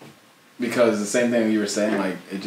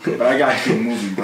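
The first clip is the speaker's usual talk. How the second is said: mock motivational; 3 semitones higher, 6 dB louder than usual. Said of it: "That sounded so motivational".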